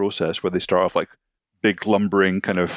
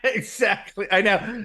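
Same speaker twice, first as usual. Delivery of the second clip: ordinary talk, nothing marked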